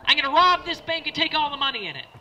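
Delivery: loud, high holdup announcement; hammed-up robber yelling orders; bank robber's shout.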